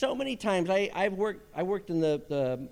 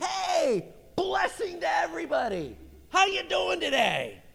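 hearty greeting call; 9 semitones higher than usual. Acted. Boaz riding up to greet his workers, hearty high shout. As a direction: call out a big greeting to the whole field.

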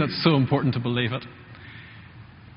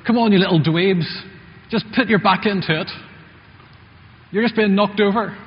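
louder, higher barked encouragement; mock PE teacher shout; 6 semitones higher, 4 dB louder than usual. PE teacher shouting at rugby pupils, mock shout. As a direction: yell at lazy schoolboys on the pitch. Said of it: "And he would shout".

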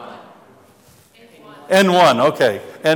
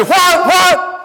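high alarmed what, what; mock startled husband at the wheel; 5 dB louder than usual. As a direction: jump at the sudden scream. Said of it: "And, and I say".

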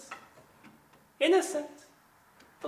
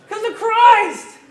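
much higher and loud, ringing out; triumphant shout; 13 semitones higher, 14 dB louder than usual. Real, not acted. Preacher answering the doubting believer, triumphant shout.

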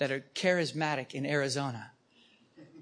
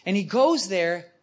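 ordinary talk, nothing marked

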